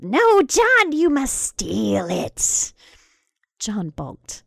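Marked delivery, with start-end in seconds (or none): 0.0-0.9 "No, John" squealed much higher and louder. Acted. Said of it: "Martha squealed". 0.9-2.7 very slow insistence. Acted. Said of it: "Martha squealed".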